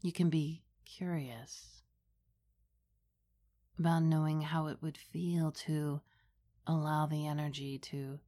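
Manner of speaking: hushed and unhurried, close to the ear; right in your ear. Acted. Leads a guided meditation.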